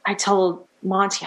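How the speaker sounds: ordinary talk, nothing marked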